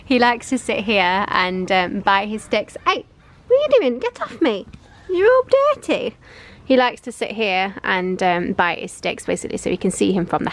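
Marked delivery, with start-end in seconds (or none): 2.9-6.1 higher mock-scold to the puppy; high playful puppy telling-off. Real, not acted. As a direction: tut at the dirty puppy playfully.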